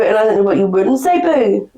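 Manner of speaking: ordinary talk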